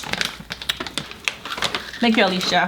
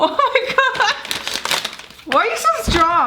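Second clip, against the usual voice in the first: high delighted amazement; amazed squeal; 13 semitones higher than usual. Real, not acted. Amazed child opened package.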